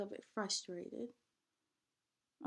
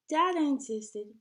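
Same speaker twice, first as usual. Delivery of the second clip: usual speaking voice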